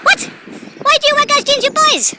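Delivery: high, loud mock-outraged question; exclaiming in disbelief. Cyclist challenging two boys about their girls' jeans, loudly incredulous. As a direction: cry out incredulously, pitched right up. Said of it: "And I was like".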